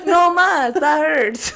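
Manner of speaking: high, loud hammed-up wail; mock baby protest. Playing a slapped newborn, high mock complaint. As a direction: yelp in pretend pain, laughing.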